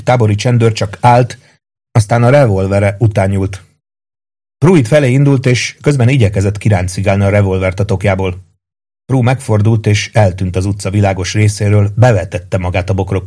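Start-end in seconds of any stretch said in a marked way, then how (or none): none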